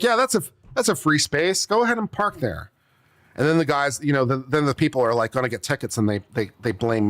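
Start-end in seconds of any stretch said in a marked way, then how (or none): 0.0-2.7 high breezy wave-through; careless go-ahead. Pretend. Attendant waving drivers into handicap space, breezy and high. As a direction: breezily wave them into the spot.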